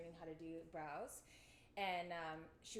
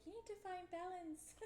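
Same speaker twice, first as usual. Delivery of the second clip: imitating Carmel; 11 semitones higher than usual. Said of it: "she's like".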